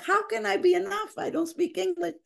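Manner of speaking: high, plaintive voice; a father who doubts himself; doubting father. Retold or imitated. Father voiced in her story.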